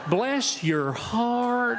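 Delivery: drawn-out, sugary Southern belle "Bless your heart"; sweet Southern lady. Retold or imitated. Speaker mimics a Southern woman's sweet warning to a room of students. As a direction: stretch it out slow and syrupy, all sweetness over a threat. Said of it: "when a Southern woman says them".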